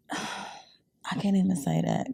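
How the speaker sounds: slow, breathy whisper after a sigh. Real, not acted. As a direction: hush it, as if it can't be said aloud. Holding back a spicy comment.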